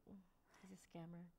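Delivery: a hushed whisper of a secret; whispered aside. Retold or imitated. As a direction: whisper it so the fans can't hear. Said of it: "just like".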